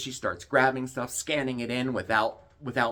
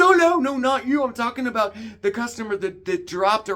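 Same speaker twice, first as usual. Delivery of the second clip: much higher and louder, hurried; mock flustered backpedal; 11 semitones higher, 7 dB louder than usual. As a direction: play the clerk scrambling to cover herself. Imitating the flustered clerk backpedaling.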